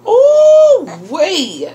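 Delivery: high, loud drawn-out ooh; delighted whoop. Real, not acted. Vlogger seeing what is in the package, thrilled whoop. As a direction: whoop at the surprise.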